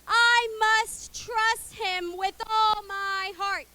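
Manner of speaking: slow, loud, word by word; slow, clear recitation. Shown as a demonstration. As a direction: spell the verse out slowly for kids. Teaching verse to repeat.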